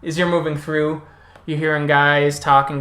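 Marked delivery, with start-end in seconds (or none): none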